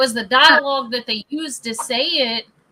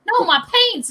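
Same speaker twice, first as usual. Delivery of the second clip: higher-pitched imitation of an insistent relative; insistent retold correction; 9 semitones higher than usual. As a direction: correct them, pitch jumping up. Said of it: "And she's like".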